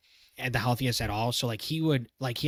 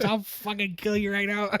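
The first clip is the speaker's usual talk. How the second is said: mock furious threat; 8 semitones higher than usual. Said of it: "he goes".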